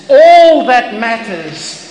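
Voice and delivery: 'all' 크게, 느리고 높게 강조; stress on all. Acted. Preacher emphasizing key point.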